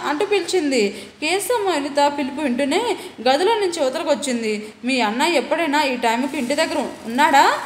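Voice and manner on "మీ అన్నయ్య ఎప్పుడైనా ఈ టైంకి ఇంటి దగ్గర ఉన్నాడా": ordinary delivery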